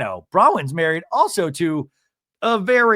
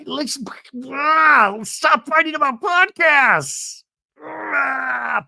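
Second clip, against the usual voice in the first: exasperated shout; 8 semitones higher, much slower than usual. Real, not acted. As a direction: yell it out, fed up with the feud. Exasperated at the show's podcast feud.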